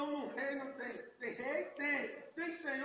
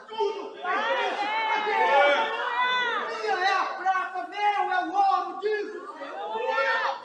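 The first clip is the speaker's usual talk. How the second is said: shouted sermon climax, slow and much louder; preaching at full force; 4 semitones higher, 13 dB louder, slower than usual. Acted. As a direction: belt it out like a preacher on fire.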